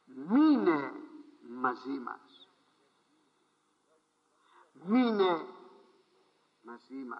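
slow, fading plea to stay; pleading stay. Retold or imitated. Disciples urge Jesus to stay, slow and pleading. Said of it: "λέγοντες".

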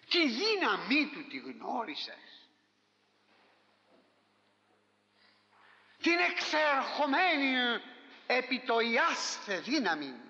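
drawn-out, weighty preacher's question; κάθε λέξη αργά, με βάρος κηρύγματος; slow solemn preaching. Real, not acted. (αργό, επίσημο κήρυγμα)

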